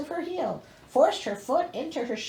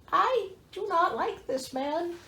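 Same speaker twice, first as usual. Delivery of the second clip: dry disapproval; 6 semitones higher, 3 dB quieter, slower than usual. Real, not acted. Slow disapproving aside.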